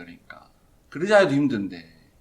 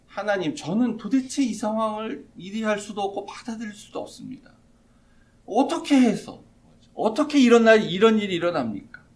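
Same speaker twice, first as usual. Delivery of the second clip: lament; 6 semitones higher, 4 dB louder than usual. Retold or imitated. Moses laments to God.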